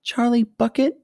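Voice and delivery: small, high, hesitant question; nervous child's high little voice. A narrator's reading. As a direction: give the name timidly, pitched high. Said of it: "a small, nervous voice whispered".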